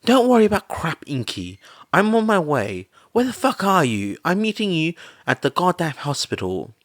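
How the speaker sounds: a friend barking orders to take charge; emphatic, loud, crude command; demanding. A narrator's reading. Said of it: "Mitsuki demanded".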